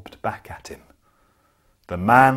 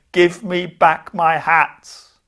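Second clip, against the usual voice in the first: slow, louder and higher shout up into the trees; cross shout; 5 semitones higher, 7 dB louder, slower than usual. Acted. Hat seller shouting up at the monkeys, slow and cross. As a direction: bellow it slowly at the monkeys. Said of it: "shouted up at them".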